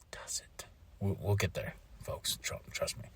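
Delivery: teasing whisper to the folks. Real, not acted. Host teasing the match result to listeners, whispered. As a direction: lean in and whisper the tease.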